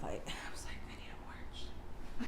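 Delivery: hushed, breathy whisper. Retold or imitated. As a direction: whisper it under your breath. Retold hungover morning thought.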